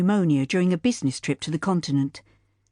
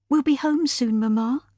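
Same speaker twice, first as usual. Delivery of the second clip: put-on higher girl's voice, gently soothing; young daughter comforting; 7 semitones higher than usual. Daughter soothes grieving mother, higher gentle girl voice. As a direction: reassure Mamma like a brave child.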